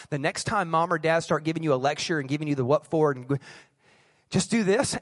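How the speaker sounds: ordinary delivery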